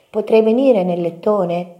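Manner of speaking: high, pleading little mouse; small voice asking a favour; Topino. A narrator's reading. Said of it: "chiese Topino". (vocina acuta che chiede)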